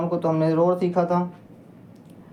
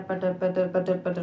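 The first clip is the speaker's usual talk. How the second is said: softly voicing the rain, पैटर पैटर repeated quietly; a gentle rain-sound पैटर; soft pitter-patter; 5 dB quieter than usual. Shown as a demonstration. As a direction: let पैटर पैटर fall soft and light like rain. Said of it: "तो ऐसे ही आवाज़ आती है".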